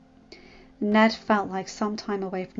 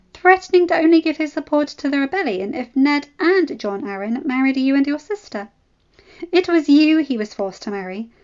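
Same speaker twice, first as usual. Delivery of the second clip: loud, raised, cutting; furious retort; 5 semitones higher, 7 dB louder than usual. Acted. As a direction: stab her with each line. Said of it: "Ella said, furious".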